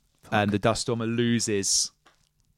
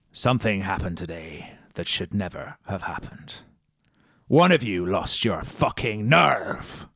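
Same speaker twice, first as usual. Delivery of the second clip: menacingly calm; 2 semitones lower than usual. Acted. Captain coldly addresses his frightened crew, menacing calm. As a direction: keep it icy calm, a hint of threat. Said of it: "he says calmly".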